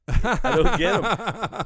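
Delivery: high, loud, slow repeat of the punchline; drawn out and baffled, lightly voiced old mother; mock baffled mother. Stephen Wright's mother admitting to a judge she doesn't get him, drawn out and loud.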